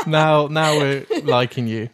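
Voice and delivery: loud laugh, slow happy words. Real, not acted. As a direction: laugh then tease warmly. Delighted at The Shining pick.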